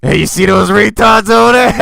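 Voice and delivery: shouted mock impression with a shrieking cackle; [name]. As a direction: blare it out as a terrible impressionist. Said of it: "[name]'s impression of Billy".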